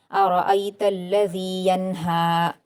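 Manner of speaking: slow recitation with a crisp unheld n in "यन्हा"; clear noon, no ghunna. Shown as a demonstration. Teacher demonstrating izhar, clearly sounded n in "यन्हा". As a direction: draw out the long vowels, keep the n of "यन्हा" distinct. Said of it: "नून साकिन की आवाज़ को जाहिर करके".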